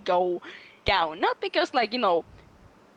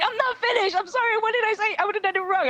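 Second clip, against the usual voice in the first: much higher mock panicked apology; flustered boyfriend; 12 semitones higher, faster than usual. Imitating boyfriend apologizing, high flustered. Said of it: "He's like".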